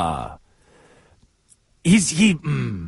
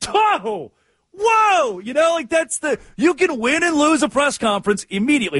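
high exclaimed whoa, then pitched-up amazement; surprised whoa; 9 semitones higher than usual. Retold or imitated. Podcaster reacting to coach's answer, high surprised exclaim.

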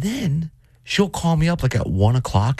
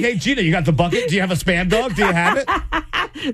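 laughing; 7 semitones higher than usual. Retold or imitated. Acted teasing quote, laughing.

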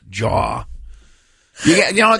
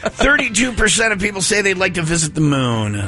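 higher-pitched quiz host announcement; raised voice pitch for the reveal; answer reveal; 6 semitones higher than usual. Real, not acted.

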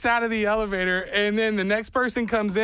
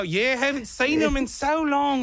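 higher, wobbly fake Australian; slipping Australian accent; 7 semitones higher than usual. He misses kangaroos to strangers, slipping fake Australian accent. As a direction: let the bogus Aussie accent slip. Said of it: "you're slipping on your accent".

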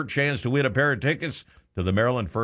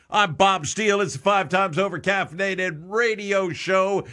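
high, bright host ID; radio announcer; 8 semitones higher than usual. Acted. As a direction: announce the show like a DJ.

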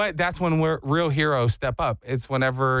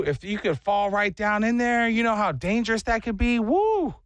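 high preachy know-it-all tone; preachy lecturing voice; 6 semitones higher than usual. Retold or imitated. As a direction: lecture about manholes in a mock-wise voice. Imitating a lecturing voice.

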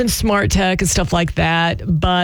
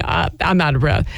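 ordinary delivery

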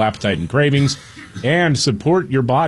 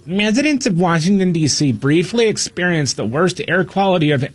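lightly whiny, higher newsreader voice; whiny liberal voice; 4 semitones higher than usual. Pretend. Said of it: "in my whiny liberal voice".

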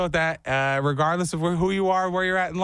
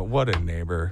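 grumbling guest; 11 semitones lower than usual. Retold or imitated. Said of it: "You go".

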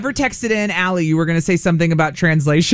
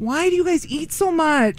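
incredulous; 8 semitones higher than usual. Real, not acted. Mock outrage at kids' appetite.

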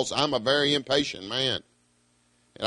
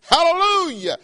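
high, soaring praise cry; ringing hallelujah; 14 semitones higher, 3 dB louder than usual. Acted. Preacher quoting the redeemed, high soaring hallelujah. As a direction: soar up on it like a crowd in heaven.

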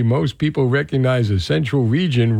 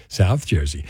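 ordinary delivery

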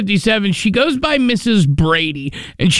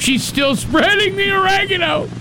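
high-pitched incredulous complaint; exasperated, much higher than usual; mock exasperation; 10 semitones higher than usual. Real, not acted. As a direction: voice climbs high in comic disbelief. Mock exasperation at endless spreading.

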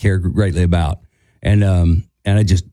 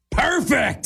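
high mock holler; Hank; 13 semitones higher, 5 dB quieter than usual. Hank hugging the singer after the song, imitated exclamation. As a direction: throw it out as one sarcastic-style cry. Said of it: "and he goes".

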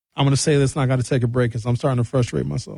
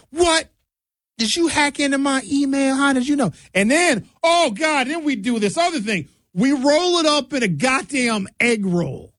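higher mock-stunned owner voice; shocked restaurant owner; 9 semitones higher than usual. Owner amazed the host guessed his menu, higher and mock-stunned. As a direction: act floored that he knew the menu.

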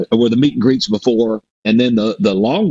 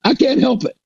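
much higher, choked-up imitation of a tough guy; retelling a biker's tearful reply, voiced much higher; mock tearful; 12 semitones higher than usual. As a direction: squeak it out like a big man fighting tears. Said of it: "He goes".

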